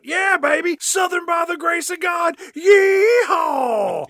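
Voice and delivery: imitated Southern cheer, building to a long yell; screaming trucker. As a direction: scream it from a passing eighteen-wheeler. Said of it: "he just screams out".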